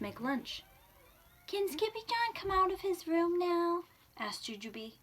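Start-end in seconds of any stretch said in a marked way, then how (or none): 1.5-3.8 put-on high-pitched pleading child; little kitten voice. A narrator's reading. Kitten sister asks Mama about her brother, high childlike voice.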